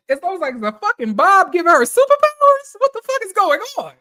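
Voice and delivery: high, loud incredulous outburst; astonished outburst. Retold or imitated. Reacting to Britt's bob giving her superpowers, astonished outburst. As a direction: exclaim it high, in hammed-up disbelief. Reliving astonishment at the bob's powers.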